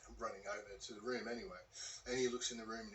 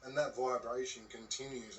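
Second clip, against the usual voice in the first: ordinary talk, nothing marked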